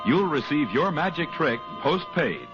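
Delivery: ordinary delivery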